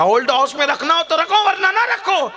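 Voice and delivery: put-on old mother defending her son, indignant; angry old mother. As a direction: voice an old woman bristling. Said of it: "بڑی بی کو غصہ آ گیا".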